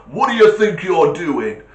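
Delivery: loud, high, affronted challenge; indignant hooter. Retold or imitated. Proper car horn telling someone off, loud and indignant. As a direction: bark it like an outraged horn. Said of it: "it's got that attitude".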